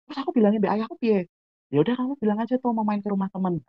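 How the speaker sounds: high-pitched imitated dialogue, quickly; acted friends' plotting. Asking friend what to tell father, high quick voices. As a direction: rattle it off in a high mock-youth voice.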